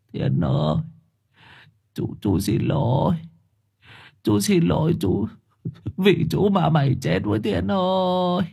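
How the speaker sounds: grieving, halting lament that climbs; tearful apology. A narrator's reading. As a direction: sob out a guilty apology to the dead. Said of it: "Khóc chán chê".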